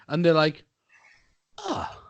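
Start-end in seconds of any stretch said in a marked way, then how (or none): 1.6-2.0 soft high gasp, then halting wonder; hushed, hammed-up disbelief; mock astonishment. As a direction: imitate someone stunned by simple kindness. Mimics astonished checkout worker.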